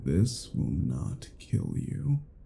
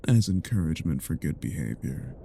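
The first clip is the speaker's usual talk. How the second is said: usual speaking voice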